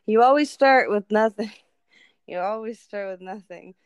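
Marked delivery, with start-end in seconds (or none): 0.1-1.5 loud, insistent retort. Retold or imitated. Mom insisting on zero to her daughter, loud and emphatic. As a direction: drive the point home loudly.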